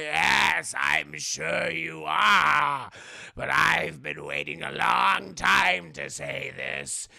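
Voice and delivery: loud, slow build to a shouted you're fired; boss firing him. Retold or imitated. Boss finally firing Goofy, building to a loud shout. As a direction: savor it, then blast it. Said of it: "He's like".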